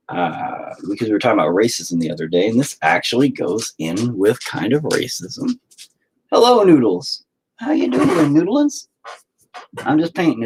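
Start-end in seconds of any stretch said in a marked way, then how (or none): 6.3-10.4 much higher, cooing greeting. Real, not acted. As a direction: coo at the pet lovingly. Greeting his pet.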